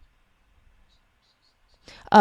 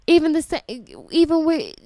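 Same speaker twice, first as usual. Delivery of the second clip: higher, broken false starts; upset stuttering; 9 semitones higher, 4 dB louder than usual. Real, not acted. Parent too upset by the video, stuttering. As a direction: stumble over the words, too upset to finish. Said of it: "got me so upset, got me stuttering".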